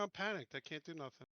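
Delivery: ordinary talk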